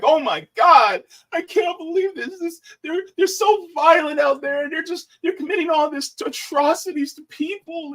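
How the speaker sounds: high, hammed-up alarmed whining; mock panic. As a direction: parody a pearl-clutching politician in a high voice. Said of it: "he's making it seem like".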